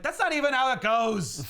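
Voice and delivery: mock exasperated correction; indignant Robin. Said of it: "Robin's like".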